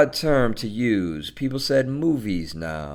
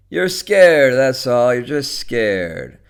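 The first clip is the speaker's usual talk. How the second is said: insistent, punchy jeer; goading taunt; 3 semitones higher, 8 dB louder, slower than usual. Acted. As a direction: taunt her forcefully, slowing at the end. Joe goads Edna on phone.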